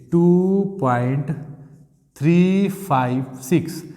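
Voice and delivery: slow, drawn-out reading of each digit; measured dictation, pausing after point. Shown as a demonstration. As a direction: spell the decimal out slowly, one digit at a time. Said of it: "point के बाद digit को पढ़ा जाता है".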